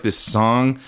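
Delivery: ordinary talk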